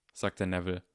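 ordinary delivery, nothing marked

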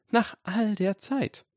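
put-on high, slow girl voice; high-pitched, drawn-out incredulous question; Granger. A narrator's reading. Granger's line in narrated dialogue.

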